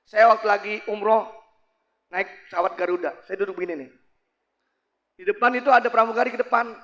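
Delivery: ordinary talk, nothing marked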